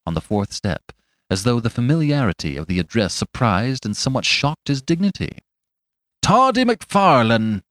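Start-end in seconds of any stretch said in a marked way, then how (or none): 6.2-7.6 high, loud, slow drawn-out call; ringing, challenging call; herald-like repeated hail. A narrator's reading. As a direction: hail him slowly and loudly by name. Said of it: "repeated Fetz".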